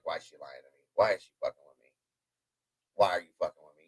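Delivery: low, quiet grumbling to himself; retold suspicious thought, kept low and under the breath; muttered inner thought. Husband silently wondering why his wife is lying, muttered.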